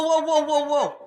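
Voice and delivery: high-pitched repeated whoas; acted warning call. Telling kids to call out warning.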